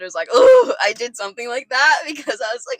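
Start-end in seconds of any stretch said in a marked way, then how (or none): 0.3-0.7 high, loud startled "oh"; loud grunt. Retold or imitated. Friend retelling the man at her door, loud grunt. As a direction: reenact a loud shocked grunt. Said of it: "loudly grunted".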